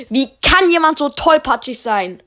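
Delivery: a hint of a fed-up headmaster; principal. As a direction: principal seeing the dented trophies, fed-up outburst. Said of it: "sagte der Schulleiter".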